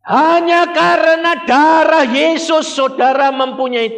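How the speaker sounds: full-force preaching. Acted. Preacher proclaiming to the congregation, high and loud. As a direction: proclaim it from the pulpit.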